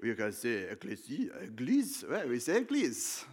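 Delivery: playful French accent, lightly put on; mock French accent. Preacher mimicking the French for the congregation, mock French accent. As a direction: tease the French pronunciation in a hammed-up French accent. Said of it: "put a French accent on it".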